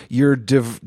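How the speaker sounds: normal talking voice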